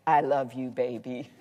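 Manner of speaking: low, hushed imitation of a gravelly husband. Wife imitating her husband waking her at night, low gravelly voice. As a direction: drop it low and soft, imitating gravelly bedroom talk. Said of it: "says in that gravelly voice".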